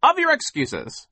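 high, loud, fed-up yell. A narrator's reading. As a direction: shout them down, out of patience. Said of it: "he yelled".

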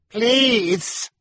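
very high, loud shouted cry; shouting. A narrator's reading. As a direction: yelp it out while falling. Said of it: "he shouted as he hit the ground".

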